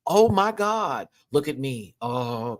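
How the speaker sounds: ordinary talk, nothing marked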